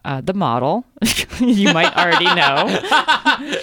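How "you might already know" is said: laughing. Real, not acted. Laughing through the book intro.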